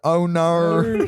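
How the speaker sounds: bad Australian accent; hammy Aussie "oh no". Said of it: "I have a terrible Australian accent".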